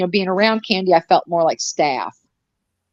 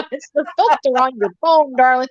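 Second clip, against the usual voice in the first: mock sweet; 5 semitones higher than usual. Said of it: "second take, mock sweet".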